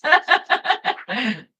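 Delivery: loud real laugh. Real, not acted. Cracking herself up over the KFC guy, loud laugh. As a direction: burst out laughing. Laughing at her own idea.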